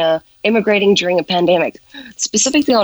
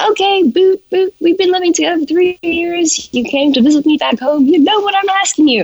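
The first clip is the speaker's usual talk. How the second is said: exasperated at partner; 11 semitones higher than usual. Retold or imitated.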